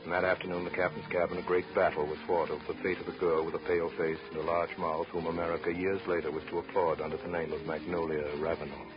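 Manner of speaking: ordinary talk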